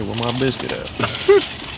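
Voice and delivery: indignant demand. Retold or imitated. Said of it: "I was gonna say".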